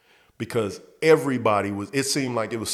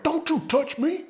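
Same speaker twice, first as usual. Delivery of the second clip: high falsetto indignant buddy; high mock coworker telling him off; mocking angry coworker. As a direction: snap back in a high put-on voice. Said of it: "and he was like".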